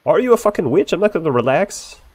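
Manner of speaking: ordinary talk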